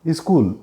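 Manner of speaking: "స్కూల్" mispronounced as "ee-skool"; extra leading vowel. Shown as a demonstration. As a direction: say "స్కూల్" with an "ee" before the s. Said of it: "అంటారు".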